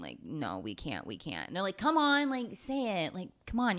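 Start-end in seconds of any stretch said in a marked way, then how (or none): 0.0-1.5 much lower and softer, hushed denial; low sheepish refusal. Retold or imitated. Heroes refusing to reveal secret. 1.8-3.8 high, wheedling coax. Retold or imitated. Press coaxing the heroes.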